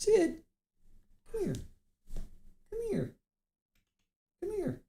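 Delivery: high, soft coaxing voice for a pet; calling the cat. Real, not acted. Host coaxing her cat Sid to come, high soft calling. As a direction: sweet-talk the cat over, way up high.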